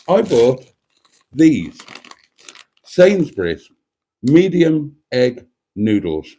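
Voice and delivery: deliberate, with long pauses between phrases; calm and measured. Acted. Imitating a shopping vlogger showing noodles, calm and measured. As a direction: present each item with measured care. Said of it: "calm and measured".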